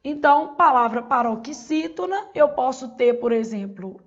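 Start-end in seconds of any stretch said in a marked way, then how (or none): none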